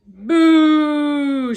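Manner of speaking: high, very loud boo; shouted boo. A narrator's reading. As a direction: jeer it out. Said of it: "shouted Botticelli".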